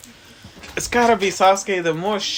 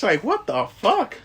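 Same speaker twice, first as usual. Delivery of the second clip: normal talking voice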